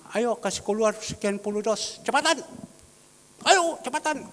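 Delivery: high, loud boss hurrying workers; urgent command, rising to a shout; barked order. Retold or imitated. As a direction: imitate an impatient boss giving orders.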